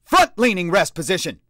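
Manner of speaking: loud, high command; barked command. A narrator's reading. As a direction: bark the order. Sergeant barks command.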